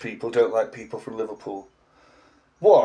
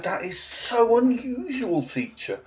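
slow, high-pitched imitation of a child; child pupil; 8 semitones higher, 4 dB quieter, slower than usual. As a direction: play a puzzled little pupil, drawing it out. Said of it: "a child said to me".

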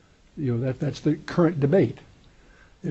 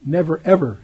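Never ever pressed hard and louder; emphatic; 6 dB louder than usual. Shown as a demonstration. Teacher shows the Greek double negative, stressed. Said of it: "it's an emphatic".

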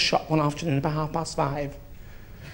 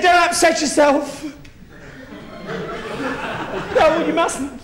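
raised, high-pitched consoling; mock consoling shout; 8 semitones higher than usual. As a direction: cry out don't upset yourself, hammed-up. Comforting someone after dog dies.

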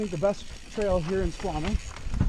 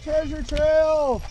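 slow, drawn-out, higher and louder; excited; 7 semitones higher, 4 dB louder, much slower than usual. Real, not acted. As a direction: stretch it out with glee. Excited repeat of trail name.

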